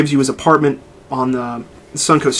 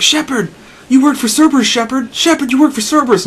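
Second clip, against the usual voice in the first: high whiny nagging voice, much higher; mocking Ashley; 11 semitones higher than usual. Gamer mocks Ashley nagging Shepard, high whiny mimicry. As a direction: mimic a nagging crewmate, pitched up. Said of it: "Ashley was like".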